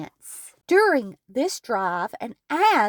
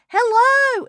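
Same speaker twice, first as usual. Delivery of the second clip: high, loud mock holler of a talking crow; 12 semitones higher, 5 dB louder than usual. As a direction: call it out like a squawky greeting. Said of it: "which would holler".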